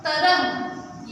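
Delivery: slow, stretched तरंग; sounding out words. Shown as a demonstration. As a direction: draw the word out slowly. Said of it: "त र ह".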